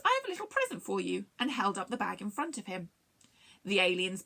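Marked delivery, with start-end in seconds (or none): 0.0-1.3 high, quick character voice; cheery greeting. Acted. Longstint greets the aliens with a present, cheery. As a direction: offer it quickly, pitched up.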